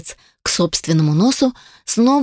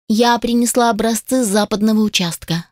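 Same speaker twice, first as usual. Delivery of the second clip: usual speaking voice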